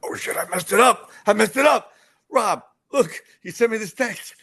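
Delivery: high, loud, frantic self-quote; oh no, I blew it; panicked. Retold or imitated. Panicking over missed text.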